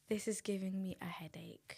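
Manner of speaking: slow, soft whining whisper; whispered whiny toddler. Retold or imitated. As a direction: sigh it out like a pained little girl. Daughter quoted, whispered.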